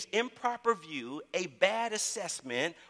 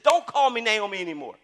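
angry retort; 6 semitones higher, 8 dB louder than usual. Retold or imitated. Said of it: "one day she was so mad".

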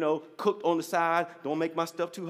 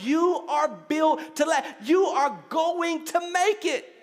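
preaching that climbs higher toward a peak; impassioned preaching; 6 semitones higher, 3 dB louder than usual. Real, not acted. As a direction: build to a rousing declaration for the congregation. Preaches at rising force.

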